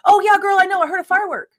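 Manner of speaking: high, loud mock-breezy reply; imitating Bethany. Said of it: "Bethany's like".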